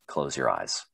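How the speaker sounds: low, soft imitated command; instructor's low order. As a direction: give the order calmly, dropped low and quiet.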